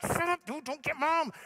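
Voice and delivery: high, acted panicky scolding; hammed-up frantic plea, pitched high; frantic hushing. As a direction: replay a dad desperately shutting his kid up.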